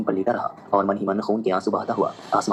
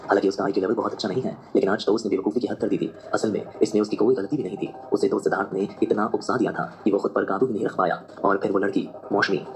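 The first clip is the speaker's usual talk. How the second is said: ordinary delivery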